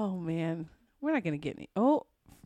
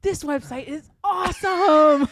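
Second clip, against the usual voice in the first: loud, high, slow delight; excited exclamation; 7 semitones higher, 7 dB louder than usual. Real, not acted. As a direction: gush about it.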